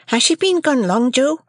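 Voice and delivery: put-on high boyish voice, anxious; little Pip asks Joe, high and worried; young Pip. A narrator's reading.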